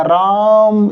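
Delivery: "राम" stretched long and even, like hailing someone; drawn-out call. Shown as a demonstration. Teacher showing how one calls out to someone, "राम" drawn long. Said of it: "इस तरीके से आवाज़ लगाते हैं".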